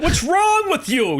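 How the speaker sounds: incredulous. Retold or imitated. Soldiers shocked he keeps performing.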